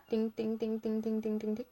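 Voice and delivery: light, repeated ting-ting noises; imitated tapping sound. Shown as a demonstration. Showing how she dabs highlighter, light ting sounds. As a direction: tick out little dabs with your voice.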